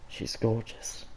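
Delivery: a soft unvoiced admiring whisper. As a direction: whisper it, slow and hushed. Breathy aside in reading.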